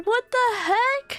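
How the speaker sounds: squeaky high what the heck; high squeaky disbelief. Real, not acted. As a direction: pitch it way up in disbelief. Reacting to Sonic gene name.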